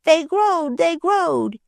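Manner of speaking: high, gleeful shout; delighted shout. Acted. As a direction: shout it while dancing around. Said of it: "he shouted".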